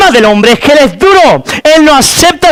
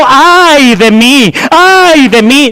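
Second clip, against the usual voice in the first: lamento; 3 semitones higher, much slower than usual. Acted. Isaías clamando ante Dios.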